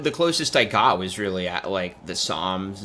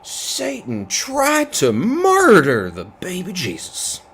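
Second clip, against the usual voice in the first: high, slow, loud preacher melodrama; hammed-up preacher mimicry; Stephen Lett impression; 9 semitones higher, 5 dB louder, slower than usual. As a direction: stretch and swoop through it in mock horror. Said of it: "I really can't do a".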